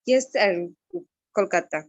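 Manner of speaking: ordinary talk, nothing marked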